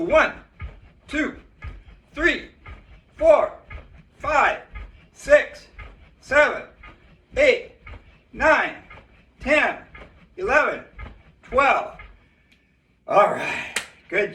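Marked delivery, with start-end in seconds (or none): 0.0-12.0 calling out the count. Real, not acted. Coach counting out jumping jacks.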